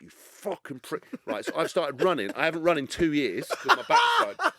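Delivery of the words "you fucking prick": slow, softer, held-in curse; muttered insult under breath. Retold or imitated. Owner cursing his runaway dog under his breath, restrained. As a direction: swear at the dog quietly so passers-by don't hear. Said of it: "trying to stay cool".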